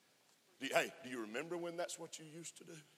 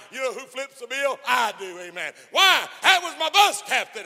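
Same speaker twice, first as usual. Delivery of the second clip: full-force preaching; 10 semitones higher, 16 dB louder than usual. Real, not acted. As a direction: lift the church with it, ringing out.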